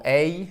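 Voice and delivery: "A" as a gliding English ay; diphthong demonstration. Shown as a demonstration. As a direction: draw "A" out into ay. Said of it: "qui a un double son".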